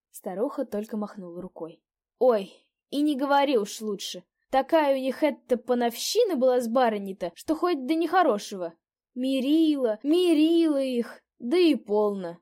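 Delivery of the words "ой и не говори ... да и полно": put-on higher, louder gossiping old woman; old landlady. A narrator's reading. Old landlady gossips to the guest about her masters. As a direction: dish the gossip about the quarrel.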